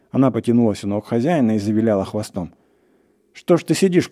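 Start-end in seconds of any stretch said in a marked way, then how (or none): 3.3-4.1 high, raised scolding question; acted cry of impatience; impatient shout. A narrator's reading. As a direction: shout at a shirker, mildly. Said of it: "крикнул охотник на лесника".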